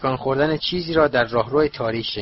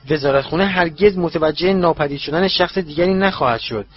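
plain reading voice

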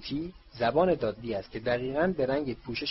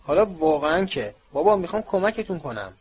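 louder, higher, fed-up protest; angry, louder cry of exasperation; exasperated anger; 6 semitones higher, 7 dB louder than usual. A narrator's reading. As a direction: snap back in frustration. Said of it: "با عصبانیت گفت".

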